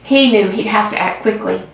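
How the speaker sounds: plain reading voice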